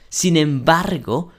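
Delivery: usual speaking voice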